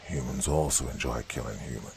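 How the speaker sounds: low and quiet, leaning on the final "humans"; stress on last word. A narrator's reading. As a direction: press the last "humans" coolly. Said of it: "putting emphasis on the last word".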